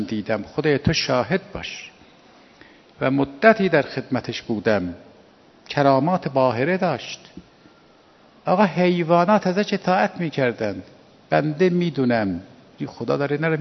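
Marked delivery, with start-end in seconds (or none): none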